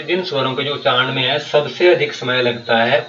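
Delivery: usual speaking voice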